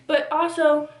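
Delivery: ordinary delivery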